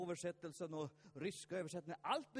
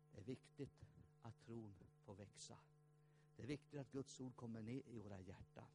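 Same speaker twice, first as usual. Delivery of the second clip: soft earnest appeal; 7 semitones lower, 10 dB quieter than usual. Real, not acted. Earnest closing exhortation.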